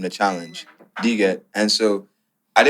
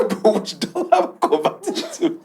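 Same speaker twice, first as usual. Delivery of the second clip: incredulous reply through laughter, higher; laughing retort. Retold or imitated. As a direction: laugh it out in disbelief. Retorting to landlord's dollar demand.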